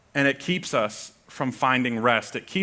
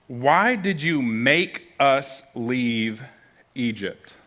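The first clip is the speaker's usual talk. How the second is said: slow drawn-out quote; much slower than usual. Shown as a demonstration. Preacher slowly rereading the verse's complaint, drawn out. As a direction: stretch out every word, very slow. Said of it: "Look at how this starts".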